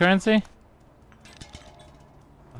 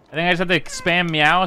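normal talking voice

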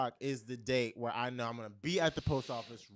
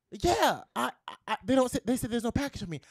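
flustered rush; 7 semitones higher, 8 dB louder, faster than usual. Retold or imitated. Man flustered at counter.

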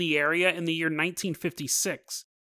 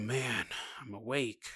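much softer, slow and groggy; dazed murmur; 7 semitones lower, 12 dB quieter, slower than usual. Acted. Zoya just woken from her frozen state, dazed and soft.

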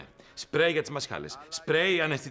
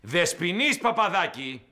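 slow, louder, insistent call by name; shouted summons; 3 semitones higher, 4 dB louder, slower than usual. Acted. Boss summoning Παπαδάκη a second time, shouted summons. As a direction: bellow the name, drawn out and indignant. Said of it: "τι φωνάζετε".

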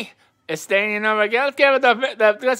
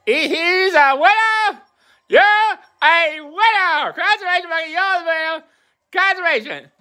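excited winner announcement; 8 semitones higher than usual. Pretend.

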